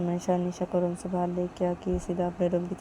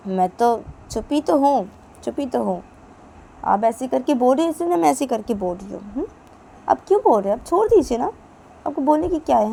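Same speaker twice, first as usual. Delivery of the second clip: loud, high indignant comeback; angry retort; 6 semitones higher, 8 dB louder than usual. Acted. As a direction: throw his words back at him. Mother snaps back at father.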